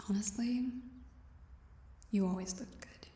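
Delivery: soft whispered confession; whispering. Acted. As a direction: breathe it, half to herself.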